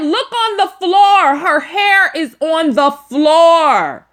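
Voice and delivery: excited insistence. Real, not acted. Teller insisting, high and loud.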